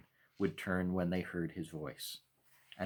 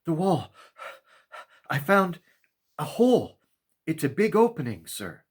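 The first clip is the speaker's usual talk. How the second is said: high, loud, gasping in spurts; young boy out of breath, blurting it out; breathless boy; 9 semitones higher, 8 dB louder than usual. A narrator's reading. Boy gasping out news of the hole to the maker, high breathless spurts. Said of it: "The boy spoke in spurts as he gasped for air".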